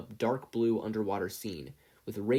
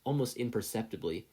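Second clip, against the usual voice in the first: plain reading voice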